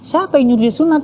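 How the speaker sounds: re-enacted incredulous outburst; indignant father. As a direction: demand to know who allowed it. Father demands who allowed it.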